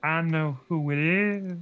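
slow, drawn-out voiced reveal; Lindy, conspiratorial. A narrator's reading. Sister revealing the culprit to Chris, slow and ominous. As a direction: stretch it out with menace. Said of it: "Lindy whispered".

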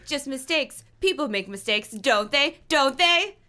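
building to a loud high shout; voice rising. A narrator's reading. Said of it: "His voice rose".

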